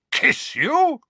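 very high, aghast echo; incredulous. A narrator's reading. Uncle echoing nephew's strange answer, incredulous. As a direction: repeat it in utter disbelief. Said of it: "his eyes popping slightly".

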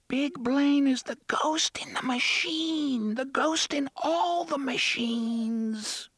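put-on high, slow child whisper; a hidden child whispering a secret; eerie ghost child. A narrator's reading. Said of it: "the unseen voice whispered".

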